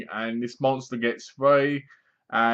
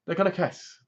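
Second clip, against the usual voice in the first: much higher, teasing sing-song; mock excited; 7 semitones higher than usual. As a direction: tease like expecting a kiss. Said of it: "and you're like".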